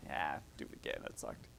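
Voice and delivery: low, whispered mock put-down; lightly voiced inner critic mocking a baby; mock harsh voice. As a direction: sneer it like a critic. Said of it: "Little baby".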